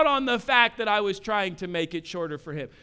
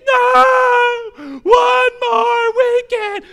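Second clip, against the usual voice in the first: much higher, loud crying wail; crying child tantrum; 12 dB louder, slower than usual. Retold or imitated. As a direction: wail it like a kid losing it. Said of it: "kicking and screaming".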